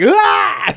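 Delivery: sudden high loud yelp of shock; startled yelp. Retold or imitated. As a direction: jump at it with a sharp cry. Guy startled when it came out.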